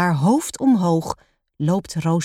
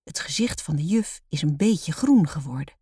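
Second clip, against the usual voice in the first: ordinary delivery, nothing marked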